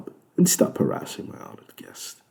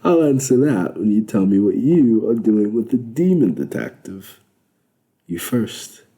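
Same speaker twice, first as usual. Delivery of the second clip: teasing; 4 semitones higher, 5 dB louder than usual. A narrator's reading. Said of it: "He grinned, wagging a finger".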